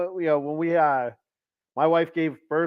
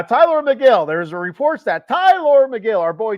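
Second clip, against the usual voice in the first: excited; 8 semitones higher, 6 dB louder than usual. Real, not acted. Host announcing new topic.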